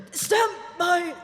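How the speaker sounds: high, choking shout; announcer's failing, high-pitched cry; strained shout. Acted. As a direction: shout it desperately between coughs. Announcer overcome by coughing on air.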